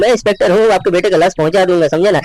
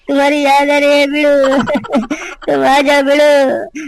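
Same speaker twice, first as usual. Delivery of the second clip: higher, slow tearful pleading; mock tearful calling; 5 semitones higher than usual. Friend crying for the missing boy to come back, slow mock sobbing. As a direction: call him home through fake tears. Mourner cries for lost friend.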